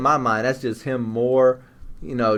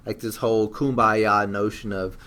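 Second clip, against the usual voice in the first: usual speaking voice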